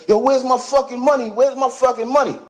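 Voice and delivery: high-pitched retold shouting demand; angry demand. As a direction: demand the money, imitating the wounded friend. Wounded Pac demanding money.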